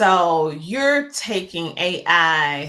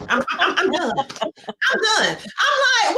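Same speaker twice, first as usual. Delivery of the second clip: high, laughing I'm done; giggling in disbelief; 9 semitones higher than usual. Real, not acted. Host blown away by the guest's idea, laughing.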